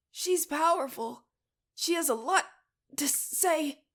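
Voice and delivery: high, loud, tense strained voice. A narrator's reading. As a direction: push through a tight throat. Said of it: "her voice strained".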